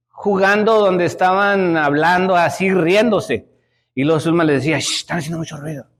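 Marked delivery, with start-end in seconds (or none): 4.8-5.9 fast hushed quote of the daughter; hushed big sister scolding; hushing whisper. Retold or imitated. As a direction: shush the kids quietly.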